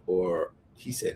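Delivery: ordinary talk, nothing marked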